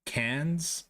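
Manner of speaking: Cairns as CANZ, no r, questioning; own pronunciation. Shown as a demonstration. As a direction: offer Cairns as CANZ, like tin cans. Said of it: "That's how I say it".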